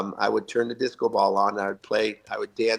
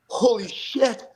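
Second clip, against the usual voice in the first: high, slow stunned "holy shit"; shocked gasp; 10 semitones higher, slower than usual. Retold or imitated. Teammate spots the owner, slow high shock. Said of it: "and he's like".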